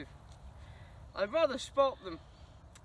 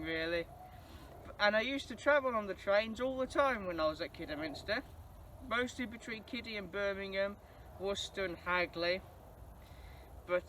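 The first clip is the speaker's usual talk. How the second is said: ordinary talk, nothing marked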